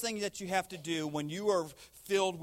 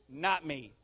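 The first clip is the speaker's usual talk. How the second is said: ordinary talk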